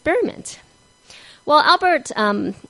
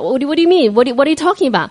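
louder, fast bewildered questions; confused and startled; 5 semitones higher, 7 dB louder, faster than usual. Retold or imitated. Albert to the convict, confused, fast and louder. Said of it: "still didn't know what he was talking about".

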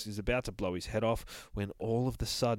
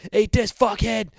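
high, loud action-hero yell; shouted taunt; 12 semitones higher, 11 dB louder than usual. Acted. Mister Buttons throws a gun at a Jarhead, yelling high. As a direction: yell it as you hurl something.

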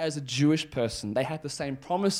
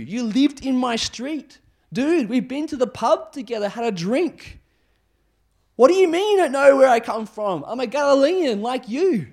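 loud, high-pitched indignant pleading; incredulous protest; 10 semitones higher, 8 dB louder than usual. Acted. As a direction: argue like a wronged old acquaintance, aghast. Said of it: "how the people here would have felt".

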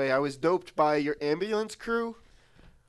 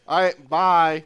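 ordinary delivery

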